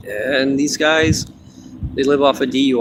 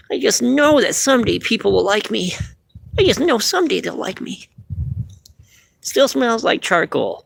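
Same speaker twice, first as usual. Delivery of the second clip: much higher, wistful puppet; hurt puppet pining, pitched up; high wounded puppet; sad puppet voice; 8 semitones higher than usual. Acted. Hurt puppet Phil speaks.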